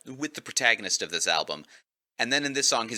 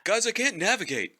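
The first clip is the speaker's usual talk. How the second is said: high, whiny upset spaceman; 11 semitones higher than usual. Retold or imitated. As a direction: play the lost Spaceman, high and put out. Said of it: "The Spaceman is particularly upset".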